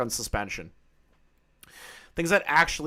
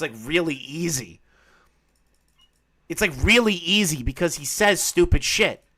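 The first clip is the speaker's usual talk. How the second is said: normal talking voice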